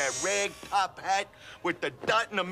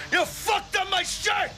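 outraged shout; 10 semitones higher than usual. Acted. Richard yells at the robbers over his ruined shirt, furious. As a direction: blow up in fury. Outraged after being shot.

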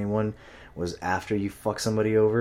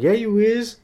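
very high, loud sing-song taunt; mock taunt; 10 dB louder than usual. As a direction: poke at him in a squeaky teasing voice. Guy taunting the clerk.

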